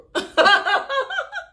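a loud laugh shooting way up high; high squealing laugh. Real, not acted. Laughing at him thinking a plane is like an Uber, high loud laugh. Cracks up over Uber remark.

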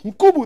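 "Nkubu" with "oo" vowels, high and loud; mistaken place name. Retold or imitated. Host retells naming the town wrongly, "Nkubu" high and loud with u sounds. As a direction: call out "Nkubu", ending in "bu". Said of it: "Then I was like".

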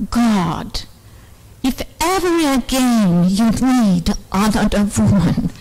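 ordinary talk, nothing marked

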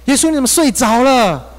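high, loud pleading cry; desperate cry to Jesus. Retold or imitated. Frightened disciple crying to Jesus in the storm, high and loud. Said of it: "就像门徒一样".

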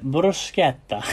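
bruschetta drawn out slowly in a light Italian accent, broo-SKET-ta; mock Italian accent. Shown as a demonstration. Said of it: "Don't know why I'm putting an accent on".

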